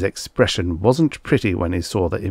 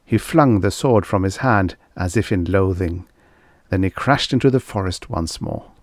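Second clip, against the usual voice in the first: ordinary delivery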